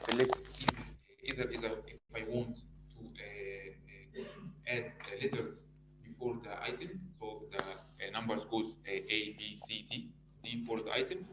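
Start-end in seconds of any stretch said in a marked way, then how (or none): 1.2-11.2 very soft, far from the mic; speaking so low it barely carries; barely audible. Real, not acted. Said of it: "your, your voice is very low".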